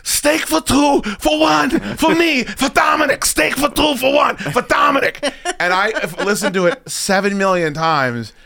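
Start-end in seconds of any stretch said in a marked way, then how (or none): 0.0-5.2 high, shouted mock order repeating itself; mocking high-pitched Steve. Mocking the friend ordering at the steakhouse, high and shouted. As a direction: squeal the order like a flustered friend. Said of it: "he goes".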